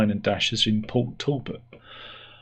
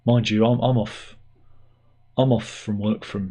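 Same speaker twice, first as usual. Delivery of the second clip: ordinary talk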